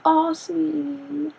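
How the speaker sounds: high, very drawn-out mock cooing; overdone sympathy. Going full friend mode on someone upset, gushing sympathy. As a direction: gush with exaggerated pity, stretching it out.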